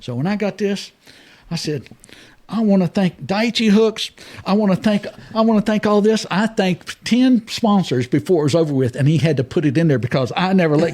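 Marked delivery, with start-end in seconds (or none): 2.5-6.2 sponsor thank-you speech. Retold or imitated. Acting out his on-camera sponsor thanks.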